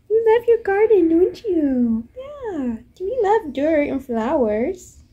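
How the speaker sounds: very high, sing-song pet talk. Real, not acted. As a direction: owner asking her turtles about their garden, high cooing. Cooing to turtles in garden.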